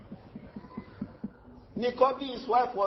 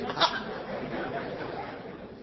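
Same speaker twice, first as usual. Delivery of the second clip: high laughing burst; 7 semitones higher, 6 dB quieter than usual. Real, not acted. Reacting to the sister story.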